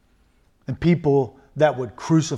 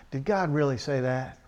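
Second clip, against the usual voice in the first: low-key doubting; 3 semitones lower, 8 dB quieter than usual. Acted. Preacher voicing a doubter's question, quiet and sceptical. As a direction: softly put doubting question.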